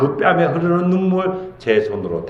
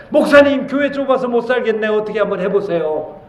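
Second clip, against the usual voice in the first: high, raised-voice plea of a churchgoer's grumble; mock complaining; 11 semitones higher, 4 dB louder than usual. Congregant complains to the pastor about a crowded church, mock complaining. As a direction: play a loud, high-pitched grumble to the pastor. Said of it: "목사님한테 막 불평했으면 좋겠어요".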